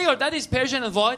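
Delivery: put-on Persian-accented English, V for W. Audience member repeating the comic's words, Persian accent. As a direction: check what you heard, in a Persian accent. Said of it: "He came up".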